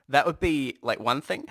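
ordinary delivery

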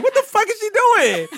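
high, loud incredulous outburst; mock bewilderment. Man voicing the pranked woman's reaction, comically bewildered.

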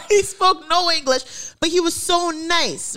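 high, loud and slowed through laughter; laughing. Real, not acted. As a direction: say it while cracking up. Cracking up at the language gap.

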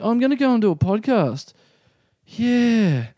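high, awkward retold reply; freaked out. Flustered man telling a celebrity his plans, freaked out. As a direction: answer nervously, mocking your flustered self. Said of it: "getting really freaked out".

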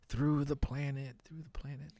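hushed run-up to an impression; zoning in. Shown as a demonstration. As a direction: practise the voice quietly to yourself. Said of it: "trying to zone in here".

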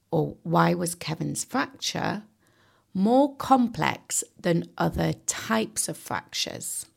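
ordinary talk, nothing marked

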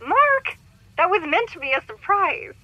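high, loud exasperated scold; scolding shriek. Acted. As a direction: shriek his name to stop him. Wife scolds husband for spoiling.

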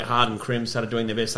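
ordinary talk, nothing marked

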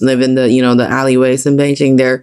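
ordinary talk

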